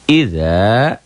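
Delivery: "Idza" with the final a held long, rising; bacaan panjang. Shown as a demonstration. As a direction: stretch the last vowel of "Idza". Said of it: "bacaan panjang". ("Idza" dibaca panjang)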